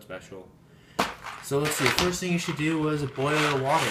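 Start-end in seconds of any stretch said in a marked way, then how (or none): 1.4-3.9 louder, higher, like a TV host shouting to viewers; loud presenter voice; loud announcing. Real, not acted. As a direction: call it out to the camera.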